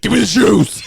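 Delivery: ordinary talk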